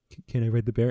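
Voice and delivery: stammered, loud imitation of an eager ask; mock stammering plea; imitating his request. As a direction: stutter into it loudly, acting out his request. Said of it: "the way he said it was like".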